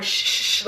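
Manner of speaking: soft unvoiced shh shh shh; imitating a cop's quiet shushing. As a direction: hush a dog quietly. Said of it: "trying to shush the dog".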